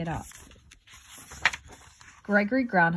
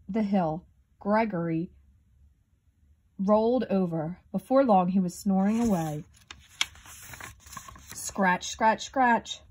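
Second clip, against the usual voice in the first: plain reading voice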